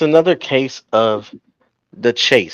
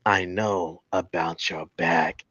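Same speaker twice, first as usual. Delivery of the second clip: quoted promo menace; 4 semitones lower, 7 dB quieter than usual. Retold or imitated. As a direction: softly recite the threat, pitched down. Quoting a wrestler's menacing promo.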